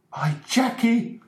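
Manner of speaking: high, slow anguished cry; shouted. Acted. Father crying out about his son, high slow shout. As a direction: cry out the name in dismay. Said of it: "shouted Mister Ferguson".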